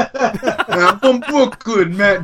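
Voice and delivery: hammed-up Brooklyn accent. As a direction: stretch out a mock Brooklyn accent. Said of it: "doesn't mean you talk like that".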